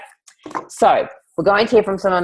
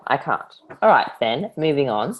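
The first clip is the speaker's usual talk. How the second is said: ordinary talk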